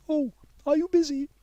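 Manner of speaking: timid schoolgirl checking in; girl Mei Yi. A narrator's reading.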